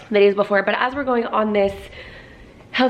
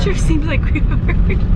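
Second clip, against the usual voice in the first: slow, louder mock echo of his words; teasing echo; 7 semitones higher, 5 dB louder, slower than usual. Wife parroting husband's remark, slow and teasing. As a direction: parrot him back slowly, teasing.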